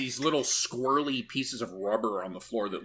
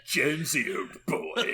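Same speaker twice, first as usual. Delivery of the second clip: bad British accent; 6 semitones higher, 4 dB louder than usual. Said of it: "A really bad British accent".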